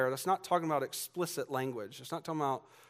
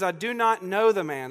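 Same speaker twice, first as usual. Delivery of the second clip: loud, higher-pitched insistence; sworn denial; 4 semitones higher, 7 dB louder than usual. Retold or imitated. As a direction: deny it firmly, sealing it with an oath. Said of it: "this time he seals it with an oath".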